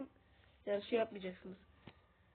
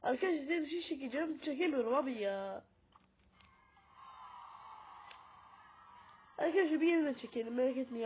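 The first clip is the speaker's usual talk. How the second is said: frustrated excitement; 4 semitones higher, 3 dB louder than usual. Real, not acted. Frustrated while failing to record.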